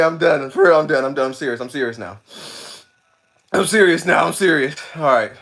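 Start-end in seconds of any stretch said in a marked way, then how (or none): none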